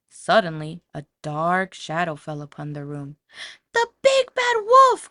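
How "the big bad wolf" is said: very high, loud slow cry of alarm; frightened cry. A narrator's reading. Pig spotting the wolf in his room, screaming in fright. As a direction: cry out in terror at the shadow. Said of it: "cried the Super Pig".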